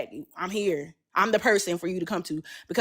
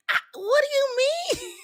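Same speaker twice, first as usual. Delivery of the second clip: much higher, squeaky what do you mean; high-pitched shocked question to her friend; stunned disbelief. Retold or imitated. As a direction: act floored by the accusation. Retold shock at being accused.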